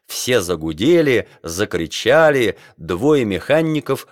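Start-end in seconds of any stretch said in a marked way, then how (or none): none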